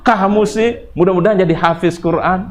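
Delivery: high-pitched, playful parental nagging; mock scolding. Shown as a demonstration. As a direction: nag as if cross while wishing well. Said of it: "kalau ngomel kayak gitu".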